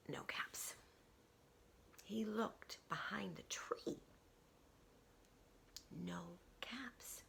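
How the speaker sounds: suspenseful whisper. A narrator's reading. Storyteller whispers the search for caps. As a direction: hush it down to a whisper.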